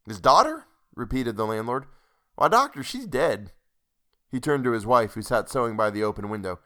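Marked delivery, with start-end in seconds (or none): 0.1-0.7 higher, louder incredulous echo; startled landlord. A narrator's reading. Landlord hearing of the dead girl's words, startled. 2.4-3.5 higher rustic voice, taken aback; startled landlord. A narrator's reading. Landlord telling the doctor of a death, startled.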